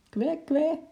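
very high quacking penguin. Acted. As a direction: quack like a frightened little penguin.

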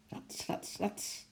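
whispered rustle; very soft, voiceless swishes; swishing sound effect. A narrator's reading. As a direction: imitate quick swishes through branches under your breath. Sound of swinging through branches.

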